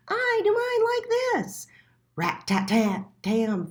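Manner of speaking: very high, loud and bouncy; rattling it out quick and proud; hare voice. A narrator's reading. The hare showing Little Mole its beans, very high and loud.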